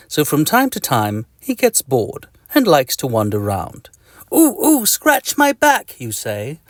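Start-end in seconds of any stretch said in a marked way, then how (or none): 4.3-6.0 high, whiny begging voice, slow; pleading itchy person. Acted. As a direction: squeak out a desperate plea for a scratch. Itchy reader begging for scratch.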